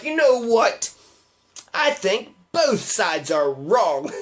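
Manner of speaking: slow, smug mock moderate voice with a laugh; mocking fence-sitter. Mocking the moderate who blames both sides, slow and smug. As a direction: sneer out the both-sides line slowly, half laughing. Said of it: "and you're like".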